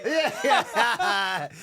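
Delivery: laughing. Real, not acted. Laughs at racist joke.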